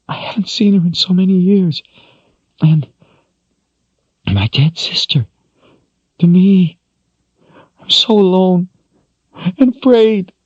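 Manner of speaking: high, tearful voice breaking into a whisper; sobbing. Acted. Kaelin mourns her mother and dead sister, sobbing. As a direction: weep out her loneliness. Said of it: "she sobbed".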